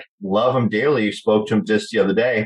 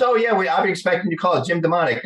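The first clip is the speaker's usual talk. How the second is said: higher, fast put-on agent voice; imitating the agent; 9 semitones higher, faster than usual. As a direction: rattle it off like a slick agent. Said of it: "and he goes".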